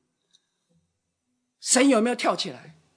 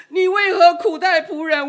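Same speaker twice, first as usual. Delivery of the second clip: high, loud pleading outcry; anguished cry; 10 semitones higher, 10 dB louder than usual. Retold or imitated. Moses crying out to God in anguish, high and loud. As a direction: cry out to God in bitter protest.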